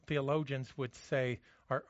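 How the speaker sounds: ordinary delivery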